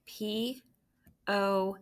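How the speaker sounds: normal talking voice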